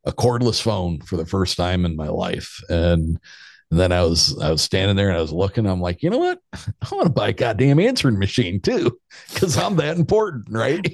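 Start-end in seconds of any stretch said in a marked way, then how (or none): 6.0-10.9 high, laughing put-on swagger; sarcastic boast about needing an answering machine; mock self-important. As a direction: play up how important you are, half laughing.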